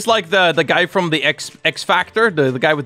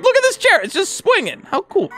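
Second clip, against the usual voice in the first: high, light, marveling voice; mock wonder; 13 semitones higher than usual. Gamer shows the swinging chair, high-pitched wonder. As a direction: act out your own delight in a high voice. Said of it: "And I'm like".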